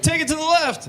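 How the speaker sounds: high-pitched rap track call-out; rapper calling moves. Acted. Rapper on the radio to a stoned driver, high hyped call-out. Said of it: "he's like".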